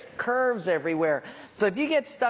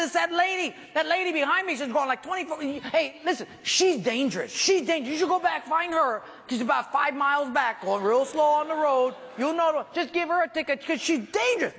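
higher, rushed pleading to the officer; frantic excuses; 8 semitones higher than usual. Retold or imitated. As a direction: push the blame onto her, pitch up.

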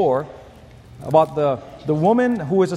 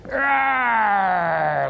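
long straining roar, slowly falling; drawn-out mock flexing roar; muscleman's growl; 3 semitones higher than usual. Shown as a demonstration. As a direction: growl like a bodybuilder flexing for the crowd. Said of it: "he does this. He goes".

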